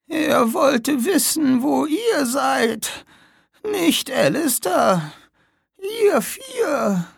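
high, slow, breaking whisper then cry; dying old woman. Acted. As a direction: struggle for breath to warn them. (sterbende alte Frau, stockend)

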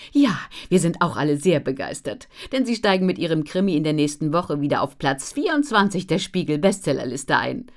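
loud, excited announcing; enthusiastic. A narrator's reading. Editor announces the bestseller list spot to Ellen, enthusiastic. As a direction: proclaim it with delight. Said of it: "verkündete Frau Allekotte enthusiastisch".

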